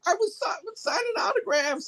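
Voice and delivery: very high, sheepish little hero voice; whiny superhero excuse. Retold or imitated. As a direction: squeak out a lame excuse.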